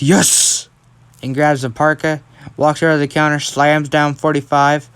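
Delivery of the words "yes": mildly raised, excited "yes"; retold triumphant shout. Deku shouts when brother agrees to buy, mild triumphant shout. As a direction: cheer it, without going full volume. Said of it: "Deku shouts".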